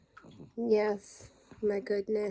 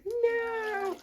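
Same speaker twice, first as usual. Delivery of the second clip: much higher squeaky refusal; voicing the dog; 11 semitones higher than usual. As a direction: answer for the dog in a high little voice. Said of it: "And she's like".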